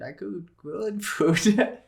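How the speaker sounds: slow, careful correction. Real, not acted. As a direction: spell it out slowly to fix the slip. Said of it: "I hope you cook better than you talk".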